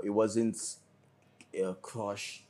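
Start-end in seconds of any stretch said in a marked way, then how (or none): none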